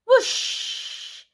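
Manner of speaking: airy whoosh of rushing water; whoosh sound, unvoiced. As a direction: blow a long whoosh. Whale spout sound effect.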